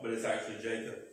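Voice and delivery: hushed aside. Acted. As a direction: drop the voice to let them in on it. Aside revealing the trick.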